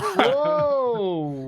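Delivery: startled cry. Real, not acted. Sudden alarm in game.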